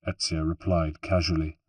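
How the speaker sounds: ordinary delivery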